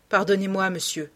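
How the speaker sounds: ordinary delivery, nothing marked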